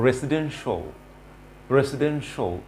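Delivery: "রেসিডেন্সিয়াল" ending in a short "sho"; correct pronunciation. Shown as a demonstration. Teacher models the word for learners, correct pronunciation. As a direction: say "রেসিডেন্সিয়াল" as "resi-DEN-sho". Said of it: "it should be শো".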